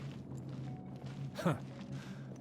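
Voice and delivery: soft unvoiced haughty laugh; smug breathy chuckle. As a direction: chuckle like a noble savoring old power. Dreams of noble glory.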